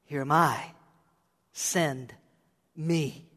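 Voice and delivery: low, soft, halting surrender; hushed, solemn offering of oneself; understated reply. Acted. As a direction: say it quietly, with a pause before each word. First, understated reading of Isaiah's reply.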